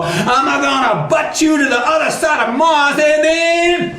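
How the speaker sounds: high, blustering threat at full windup; angry Brer Rabbit. Acted. Rabbit about to butt Tar Baby, high angry bluster. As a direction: build to the blow in a high voice. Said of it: "he winds it up some more".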